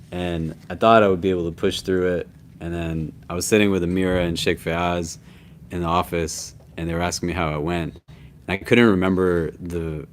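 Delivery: drowsy, low and tired; half asleep. Real, not acted. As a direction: talk like you barely slept. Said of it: "I'm, like, half asleep".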